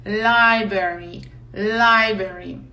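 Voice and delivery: "Library" said slowly as LYE-beri, first R nearly silent; slow model pronunciation. Shown as a demonstration. Teacher models "Library" for students, slow and clear. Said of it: "o primeiro R não tem muito som".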